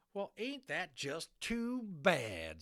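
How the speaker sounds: mock the Indian with scorn; sneering cowboy sarcasm; sarcastic. A narrator's reading. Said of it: "said Boone sarcastically".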